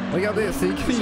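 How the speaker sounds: burp breaking into the words; talking while burping. Real, not acted. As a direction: speak through a small burp. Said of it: "Oh, j'ai roté".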